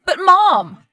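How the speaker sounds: loud, high wail of protest; protesting cry. Acted. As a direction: cry out to your mother in dismay. Evicted daughter's last protest.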